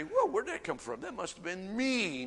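playful voicing of a baffled listener; startled reaction. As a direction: jump up in pitch, mock-startled. Acts out startled inner reaction.

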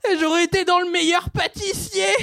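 loud gushing shout; excited ovary voice. Acted. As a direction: shout it out with glee. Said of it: "j'ai fait parler mes ovaires".

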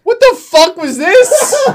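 high, loud disbelieving laugh-cry; stunned disbelief. Real, not acted. Reacting to the Sasquatch genocide, high and stunned. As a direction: reel in shocked disbelief. Shocked reaction to sad quest.

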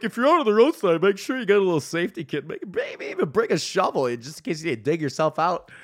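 mock a chirpy radio advisory; lightly hammed-up radio PSA; radio announcer. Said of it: "some dumbass on the radio told me".

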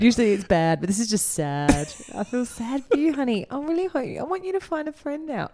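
teasing sympathy for the friendless singer; mock pity. Real, not acted. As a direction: pity him theatrically.

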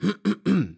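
high, performed throat clearing. Acted. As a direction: clear the throat self-importantly before reciting. Alois prepares to recite. (gespieltes, hohes Räuspern)

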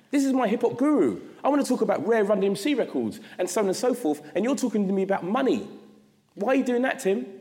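high-pitched, let-down fan protesting; exasperated disbelief. Retold or imitated. Young fan to his hip-hop guru, exasperated disbelief. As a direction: plead in mock dismay with your idol. Incredulous fan's complaint to his idol.

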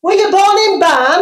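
loud, high, hammed-up Yorkshire accent. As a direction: call it out in broad Yorkshire. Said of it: "that's more Yorkshire accent".

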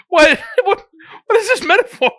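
incredulous. Real, not acted. Incredulous at metaphor.

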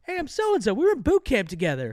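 high, loud, cheery call; light up like you spot an old buddy; excited greeting. Retold or imitated. Guard recognizes old friend.

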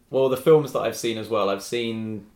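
usual speaking voice

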